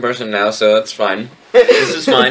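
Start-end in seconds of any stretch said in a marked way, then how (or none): 1.5-2.3 high, laughing ironic line; sarcastic. Real, not acted. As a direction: say it with amused irony.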